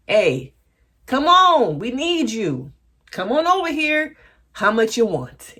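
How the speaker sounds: high, loud, pleading sales pitch; mock eager persuasion; urgent coaxing. Acted phone pleas to the comedian.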